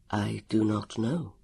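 slow, low, grave answer; measured, quiet solemnity; serious. A narrator's reading. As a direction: answer softly and gravely, word by word. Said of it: "Elio explained seriously".

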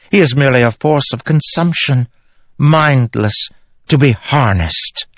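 high, slow cold pronouncement; possessed queen coldly explaining to Kaladin; menacing queen. Acted. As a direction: dismiss him with dark pride.

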